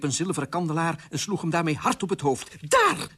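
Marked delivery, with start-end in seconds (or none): 2.7-3.1 very high, loud outburst; lost patience. Acted. Queen strikes king with candlestick, furious outburst. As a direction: fling it out sharply and high. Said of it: "verloor de koningin haar geduld".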